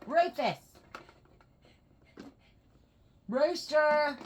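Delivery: high, loud pet-name calls; calling the dog. Real, not acted. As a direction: call your dog over from across the room.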